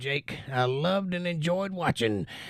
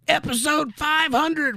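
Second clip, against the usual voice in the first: higher, punched milestone reveal; excited announcement; 9 semitones higher, 4 dB louder than usual. Real, not acted. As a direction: proudly hype the big number. Hyping the milestone show to viewers.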